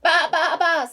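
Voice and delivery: high, very loud repeated bleats; sheep baa. A narrator's reading. Hen tricks the robbers, loud high baas. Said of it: "said the fat red hen".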